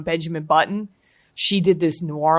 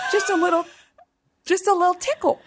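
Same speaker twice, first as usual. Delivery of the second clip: tiny, much higher-pitched teasing voice; teasing squeak; 11 semitones higher, faster than usual. Pretend. Nudging the host to try it, teasing high voice. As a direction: coax playfully in a high little voice.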